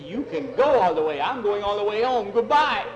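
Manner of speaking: defiant, rising almost to a yell; loud refusal. Acted. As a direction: refuse at full volume, desperate to leave. Boy refuses, heads for home.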